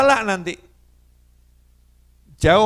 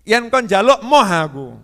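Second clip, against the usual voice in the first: raised, louder refusal in Javanese; stubborn retort; 4 semitones higher, 6 dB louder than usual. Retold or imitated. Speaker answers Habib back, refusing to ask, stubborn raised voice. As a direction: insist on it, pitched up. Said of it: "Ngeyel saya".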